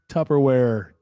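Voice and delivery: "Tupperware" with a clear P and full R; saying "Tupperware" the standard way; plain "Tupperware". Retold or imitated. Quoting the Tupperware bit, says "Tupperware".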